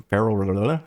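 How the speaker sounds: tripping over the L at the end of "Feral"; tongue-tied. Real, not acted. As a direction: stumble on "Feral", slurring r and l together. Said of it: "Why is that so hard to say?".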